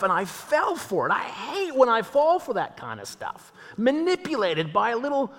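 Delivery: comic sulking God, muttering to himself at the mountaintop; exasperated God. Retold or imitated. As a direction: grumble like someone who just got tricked. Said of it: "and then went".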